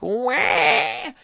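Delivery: high mock baby wail; exaggerated, high-pitched "wah" of a crybaby; imitated crying wail. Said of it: "when she cries, it's like it's the end of the world".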